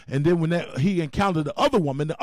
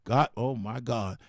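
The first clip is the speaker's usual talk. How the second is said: ordinary talk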